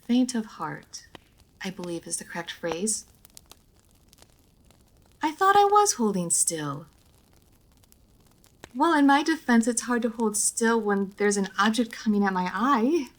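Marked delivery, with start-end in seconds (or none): 5.2-13.1 higher and louder, flustered defence; huffy complaint at the makeup artist; indignant protest. Acted. Protesting makeup poke at eye.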